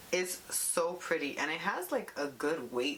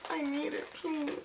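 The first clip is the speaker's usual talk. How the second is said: high mock begging, a touch of whine; mock pleading; 9 semitones higher than usual. Retelling a sold-out palette, mock pleading. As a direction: plead like a spoiled child. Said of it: "and I was like".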